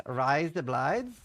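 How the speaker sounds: "rise the blides" in a slow Australian accent, razor as "rise" and blades as "blides". Shown as a demonstration. Demonstrating how an Australian says "rise the blides". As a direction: say "rise the blides" the Aussie way, slowly. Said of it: "sounds a bit like rise up lights".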